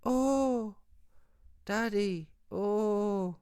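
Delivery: high, drawn-out mock whimper; mock crying little girl. Mocking Devin crying to his pops, high mock crying. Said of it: "Crying like a little girl".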